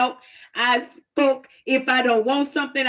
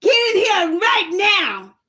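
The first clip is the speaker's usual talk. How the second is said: slow, louder scolding grandma; old folks' scolding; 7 semitones higher, 3 dB louder, slower than usual. Retold or imitated. Grandma scolding the child to come inside, slow. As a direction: drag it out like an old lady scolding. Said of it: "You know the old folks, how they talk".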